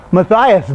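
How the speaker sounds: Matthias, stressed on a long "eye"; Greek pronunciation. Shown as a demonstration. Teacher repeating the name for a class, Matthias with a long I. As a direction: sound out Matthias as muh-THIGH-us. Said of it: "here the I's are pronounced as I's".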